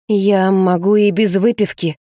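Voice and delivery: slow, slightly wavering reply; trembling voice. A narrator's reading. Rina answers Anton's jibe about drinking, voice slightly trembling. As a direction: draw it out with a touch of a tremble. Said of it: "сказала она дрожащим голосом".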